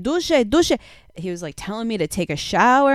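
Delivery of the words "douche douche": panicked Frenchman. Retold or imitated.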